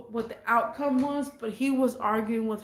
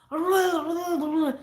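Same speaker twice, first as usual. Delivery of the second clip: put-on drunken babbling; 5 semitones higher than usual. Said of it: "I don't understand what he's even saying".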